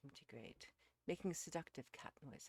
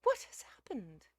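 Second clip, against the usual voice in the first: high, anxious demand for news; agitated; 7 semitones higher, 14 dB louder than usual. A narrator's reading. Missus Uckridge questions Beale, agitated. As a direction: ask it in alarm, pitched up. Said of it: "cried Missus Uckridge in agitation".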